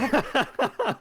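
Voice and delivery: high burst of laughter; real high-pitched laugh. Real, not acted. Game master reacts to a killing blow, laughing.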